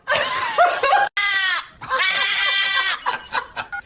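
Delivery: long, high shrieks of laughter; helpless high laughing. Real, not acted. Reacting to the parrot's squawk.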